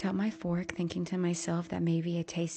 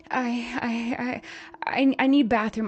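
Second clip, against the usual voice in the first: louder, higher frightened stammer; stammering panic; 5 semitones higher, 7 dB louder than usual. A narrator's reading. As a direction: trip over the words in fright. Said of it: "I fumbling at my words".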